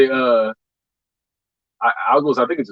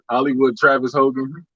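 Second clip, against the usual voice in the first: ordinary talk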